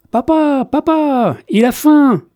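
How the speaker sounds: put-on high child's voice calling Papa from another room; sing-song call of a small girl to her father; child announcing the penguin is hungry; little girl calling. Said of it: "annonça la fillette".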